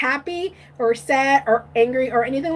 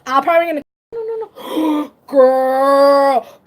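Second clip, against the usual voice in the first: ordinary delivery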